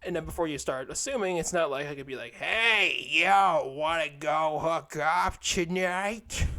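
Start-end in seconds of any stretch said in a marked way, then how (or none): 2.4-6.5 slow, put-on come-on; silly pickup voice. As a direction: draw out a goofy pickup line. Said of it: "Why'd I do that voice?".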